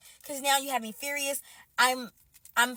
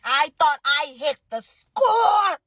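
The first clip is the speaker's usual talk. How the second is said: loud, slow, drawn-out cry; hammed-up lament; mock wail; 2 semitones higher, 6 dB louder, much slower than usual. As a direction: stretch "score" into a hammed-up wail. Mock wailing over lost jackpot.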